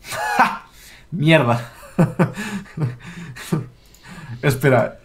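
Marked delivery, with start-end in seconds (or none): 0.0-2.3 laugh, then curse the loss; laughing dismay. Real, not acted. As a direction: yelp then frustrated curse. Losing the coin toss.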